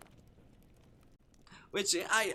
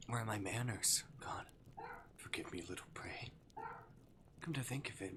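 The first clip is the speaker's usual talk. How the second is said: lightly voiced demon toying with prey; teasing Arctic demon; 2 semitones lower, 7 dB quieter than usual. Demon to his captive human, playfully menacing.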